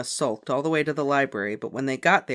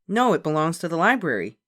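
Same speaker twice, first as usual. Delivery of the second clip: explaining; 4 semitones higher, 6 dB louder than usual. A narrator's reading. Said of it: "Beezus explained".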